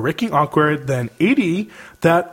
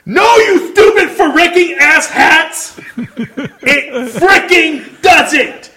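yelling; 11 semitones higher, 6 dB louder, slower than usual. Acted. Reading angry email aloud, yelling.

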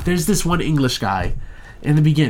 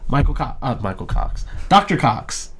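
usual speaking voice

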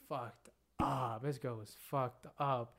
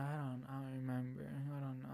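mumbled, softer retold reply; completely gone; 8 dB quieter than usual. Friends ask a wasted girl where she lives, completely gone. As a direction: imitate someone barely conscious, quietly. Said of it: "this girl's just completely gone".

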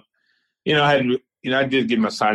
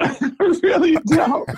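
laughing; slower than usual. Real, not acted.

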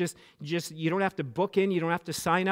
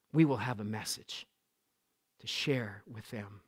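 hushed solemn close; 7 semitones lower, 9 dB quieter than usual. Acted. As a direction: draw them in quietly toward prayer.

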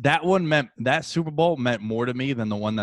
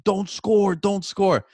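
raised, louder imitation of a yelled warning; imitated yell; 10 semitones higher, 6 dB louder, slower than usual. Quarterback yelling at his running back, imitated yell. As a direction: call it out high, mimicking an urgent yell. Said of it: "Eli's yelling at him".

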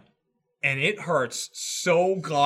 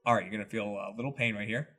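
apologetic doctor; 5 semitones lower, 3 dB louder than usual. Retold or imitated. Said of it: "But he would go".

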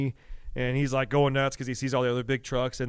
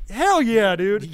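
higher, louder cheer; pumped dad; 8 semitones higher, 8 dB louder, much slower than usual. Retold or imitated. Dad cheers back at his son, higher and louder. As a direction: hype him up.

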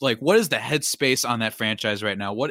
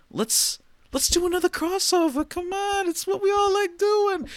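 excited. Pretend. Hyping the crossover.

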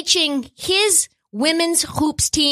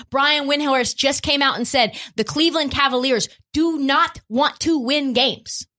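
ordinary talk, nothing marked

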